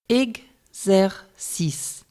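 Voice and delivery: "Exercice." drawn out very slowly, syllable by syllable; slow syllable demo. Shown as a demonstration. Teacher showing learners how the syllables split, very slowly. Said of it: "On prononce les syllabes de la manière suivante".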